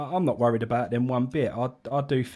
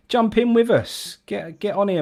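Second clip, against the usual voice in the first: calling out; 8 semitones higher, 5 dB louder than usual. Real, not acted. Calling a commenter onto stream.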